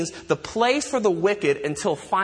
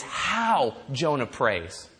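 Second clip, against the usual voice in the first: "How" drawn out slowly, then a lower finish; slow, lowered delivery weighting "How"; stress on How; 5 semitones lower, 4 dB quieter, much slower than usual. Real, not acted. Contrasts how with that Jonah prays.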